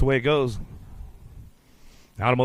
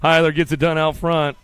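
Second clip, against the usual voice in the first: usual speaking voice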